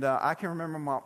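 usual speaking voice

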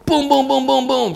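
high, rapid booms like fist blows; booming knock imitation; imitated door pounding.

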